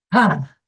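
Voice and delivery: loud, long voiced sigh on the out-breath; vocalized exhale. Shown as a demonstration. Teacher demonstrates vagus nerve breathing, a loud voiced exhale. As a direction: let the breath out with sound, glad to be safe. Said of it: "giving it a vocalization while you're, so you're going".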